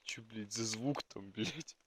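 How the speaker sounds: hushed and uneasy; quiet, worried question into the dark; nervous night vlog whisper. Real, not acted. Hearing a strange sound.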